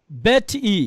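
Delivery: "বেট ই" with a separate long final EE; hypothetical mispronunciation. Shown as a demonstration. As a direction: detach and draw out the ই in "বেট ই". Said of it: "তাহলে আমার উচ্চারণ কেমন হওয়া উচিত?". ("বেট ই", শেষে আলাদা লম্বা ই)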